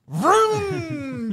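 high, drawn-out vroom; playful high engine whoosh; imitated zooming noise. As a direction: imitate a wheelchair speeding off. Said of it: "he just, like".

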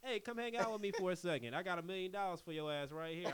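loud, cocky rich-guy swagger; cocky playboy. Retold or imitated. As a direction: brag like a sleazy billionaire. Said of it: "he's billionaire playboy".

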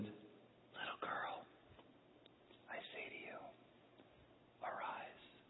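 slow, hushed whisper; tender whisper. Acted. Jesus to the little girl who died, tender whisper. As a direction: whisper it slowly, with long pauses.

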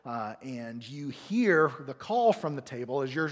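usual speaking voice

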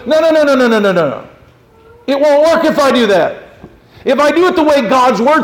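high, loud whiny no's; mock refusal. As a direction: play the stubborn believer refusing. Imitating believers refusing God.

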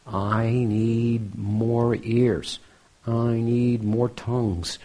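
slow, drawn-out plea; acted longing, words held long; troubled pleading. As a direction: stretch each word as you plead. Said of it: "I'm troubled".

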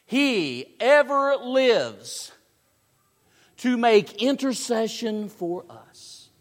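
loud, high, drawn-out proclamation; emphatic preaching. Real, not acted. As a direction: proclaim it slowly to the congregation.

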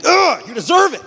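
high, loud retold angry groan and words; mad outburst. Teen mad at mom over a Halo game, angry outburst. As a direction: blurt it out, acting mad. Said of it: "like, mad".

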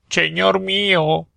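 high, long-drawn Auvergnat protest; indignant high Auvergnat exclamation; Auvergnat accent. A narrator's reading. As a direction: cry it high and slow, s as "ch". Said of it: "Ya eran dos a destrozar el idioma".

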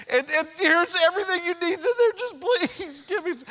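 high, strained mock-desperate plea to the nurse; desperate pleading. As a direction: beg for drugs like you're at your limit, voice pushed up high. Begging ER clerk for drugs.

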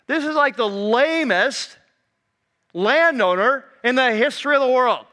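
hammed-up exasperation from the crowd; exasperated rant. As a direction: rail against a spineless leader. Imagined irritated crowd member ranting.

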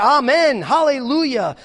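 very loud, high shout of praise; full-voiced jubilant shout; exultant cry. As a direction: cry it out to heaven. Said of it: "And they cried".

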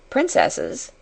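ordinary delivery

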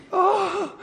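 high, drawn-out mock teen "Oh"; teenage girl sigh. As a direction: ham up a dramatic teenage girl. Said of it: "teenage girl says that".

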